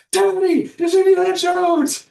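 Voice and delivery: put-on high, loud child's yell; a little boy shouting with delight at a movie star; excited kid shout. Son spotting Harrison Ford, yelling excitedly at top of lungs. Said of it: "Top of his lungs".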